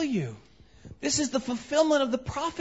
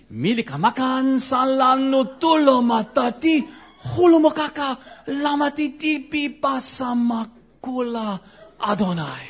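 mock tongues; 7 dB louder than usual. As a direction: belt out made-up tongues.

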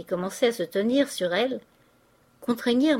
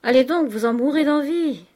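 teasing urging; 5 semitones higher, 6 dB louder than usual. A narrator's reading. Bianchon teases Poiret loudly.

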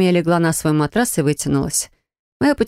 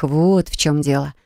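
plain reading voice

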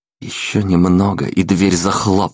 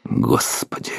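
whispered; 6 dB quieter than usual. Acted. Narrator's whispered aside.

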